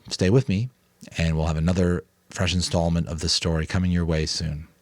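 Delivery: ordinary delivery, nothing marked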